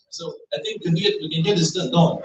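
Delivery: put-on light South African accent; hint of a South African accent. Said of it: "so I'm trying to mimic her".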